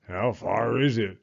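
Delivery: a touch of a grumble; grumble, unimpressed. Acted. Beaver grumbling about the walk. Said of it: "grumbled Beaver".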